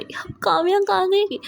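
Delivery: high, startled call; high alarmed call on waking alone; alarmed question. Acted. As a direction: voice jumps up in sudden worry. Wakes, friend missing, alarmed.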